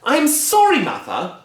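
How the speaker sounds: high, loud, apologetic; flustered daughter. Acted. Missus Cranky appeasing Grandma, high flustered apology. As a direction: placate her mother. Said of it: "Missus Cranky said".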